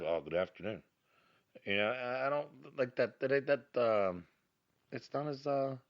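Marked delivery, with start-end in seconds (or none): 0.0-0.8 lower, softer and flat-toned; a grudging afternoon greeting; dull good afternoon. Shown as a demonstration. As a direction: mumble it without cheer. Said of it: "instead of".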